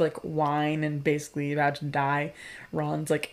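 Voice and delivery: ordinary delivery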